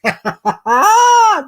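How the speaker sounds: high, loud burst of laughter; helpless laughter. Real, not acted. Reactor tickled by the sarcasm, helpless laughter. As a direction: crack up on a high squeal.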